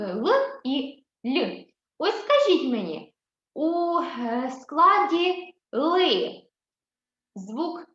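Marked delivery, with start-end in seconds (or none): 4.5-6.4 "ли" dragged out very slowly; slow emphasis. Shown as a demonstration. Teacher stretches "ли" so children hear the consonant, slowly. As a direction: stretch "складі ли" to let them hear the Л. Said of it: "Л м'який чи твердий".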